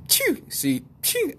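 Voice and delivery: high squeaked "chew" sneeze; mock sneeze. Imitating wife sneezing.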